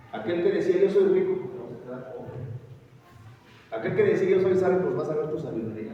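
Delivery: forceful sermon delivery; loud preaching. Acted. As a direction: thunder at the proud from the pulpit. Preacher mocks the proud.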